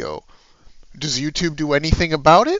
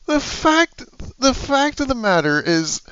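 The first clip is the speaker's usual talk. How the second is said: usual speaking voice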